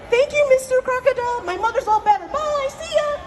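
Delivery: high breezy farewell; cheeky rabbit. Acted. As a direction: toss off a gleeful goodbye as you run away. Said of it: "runs away and say".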